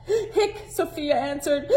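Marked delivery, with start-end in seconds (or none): 0.1-0.7 hiccup sounds, very high; squeaky hiccups. Acted. Sofia hiccups.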